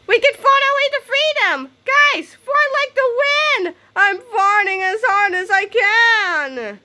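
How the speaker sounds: very high, loud, slowing strain; frantic rallying cry. Acted. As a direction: shout it all out with effort. Heroes straining to escape.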